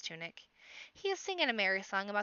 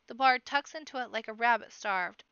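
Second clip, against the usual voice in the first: plain reading voice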